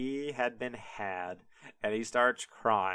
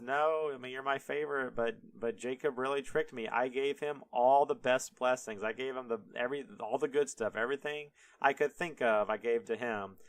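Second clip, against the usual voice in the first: ordinary delivery, nothing marked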